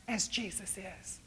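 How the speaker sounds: sussurro intimo e lento; hushed whisper. Real, not acted. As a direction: sussurra come una confidenza. Intimate sermon moment.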